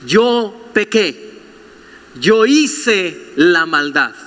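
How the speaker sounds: slow confession. Shown as a demonstration. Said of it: "Que sean capaces de decir".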